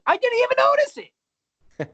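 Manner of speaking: loud, very high and drawn-out exclamation; slow, loud squawk of amazement; amazed outburst. Real, not acted. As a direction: burst out in high-pitched disbelief. Exclaims over unnoticed hat theft.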